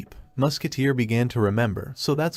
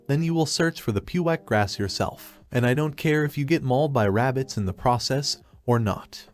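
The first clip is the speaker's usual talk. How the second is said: ordinary delivery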